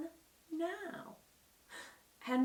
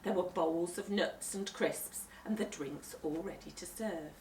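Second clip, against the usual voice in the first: ordinary delivery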